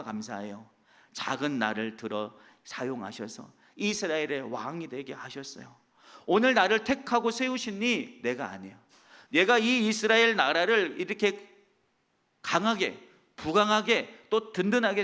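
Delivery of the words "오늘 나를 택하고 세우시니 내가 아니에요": ordinary delivery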